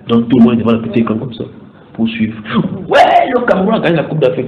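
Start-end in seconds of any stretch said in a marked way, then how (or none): 2.9-4.5 high, loud mock victory cry; imitated jubilation, starting high and loud; acted fan celebration. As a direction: shout it like a cheering supporter. Acting out celebrating football fans.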